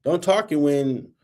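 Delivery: ordinary delivery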